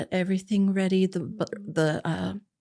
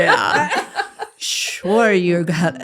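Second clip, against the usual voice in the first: loud mock disbelief; 8 dB louder, much slower than usual.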